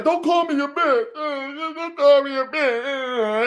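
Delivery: high, drawn-out fake wail; hammed-up tearful protest; mock crying. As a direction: whine it as if sobbing to a father. Acts out tearful reply to God.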